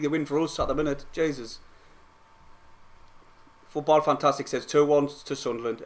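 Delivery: normal talking voice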